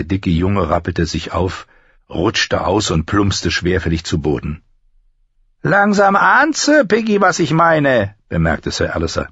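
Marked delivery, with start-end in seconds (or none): 5.6-8.2 high-pitched, sneering remark; mocking. Master-at-arms remarks on the fallen boy, mocking. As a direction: gloat over the struggling recruit, pitched high.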